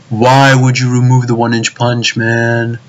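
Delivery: ordinary talk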